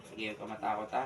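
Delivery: soft, low and soothing; gentle reassurance. Real, not acted. Calming a scared child. (mahinahong pagpapakalma)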